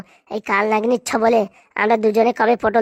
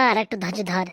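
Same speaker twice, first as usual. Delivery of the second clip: quietly repeating আর একটু ধৈর্য ধর, much softer; hushed repeat to calm her daughter; soft echo; 5 semitones lower, 6 dB quieter than usual. Acted. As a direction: say it again under the breath, soothing. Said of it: "আর একটু ধৈর্য ধর রে মা".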